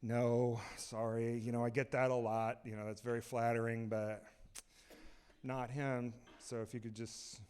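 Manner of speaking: soft, low, understated brush-off; imitated Ryan Gosling. Movie star politely sending fans on their way, hushed and low. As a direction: turn them away quietly in a low voice. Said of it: "And he's like".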